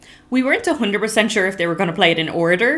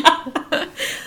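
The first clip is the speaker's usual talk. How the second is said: laugh; 11 semitones higher than usual. Real, not acted. Laughing at the joke.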